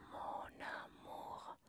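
mon amour slowly linked, N joined to A as one word; liaison. Shown as a demonstration. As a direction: glide mon amour together with no break, slowly.

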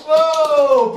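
loud, high burst of wonder; excited wow. Real, not acted. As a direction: cheer the shot, way up and loud. Toy launch nearly hits target. (głośne, wysokie „wow” z zachwytu)

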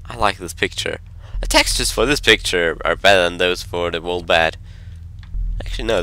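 ordinary talk

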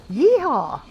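a joyful cowboy holler; cowboy yeehaw. Real, not acted. Gamer sets off to hunt Maywings with a yeehaw holler.

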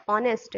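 "ऑनेस्ट" starting on the O vowel, H dropped; teacher's clear "ऑनेस्ट", vowel first; silent H. Shown as a demonstration. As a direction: model "ऑनेस्ट" without any H sound. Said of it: "इसका सही pronunciation होता है".

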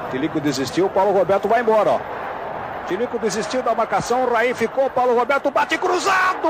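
loud fast building call; explosive goal commentary. Real, not acted. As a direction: build to a shout. Building attack to shot.

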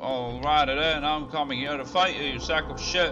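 loud, high hammed-up trash talk; mock fight challenge. Streamer challenging a game monster, hammed-up loud taunt. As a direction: bark it up at the enemy like a brawler.

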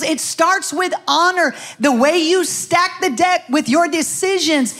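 impassioned altar-call preaching; preaching at full force. Acted. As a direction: drive the point home to the church. Preaching to congregation.

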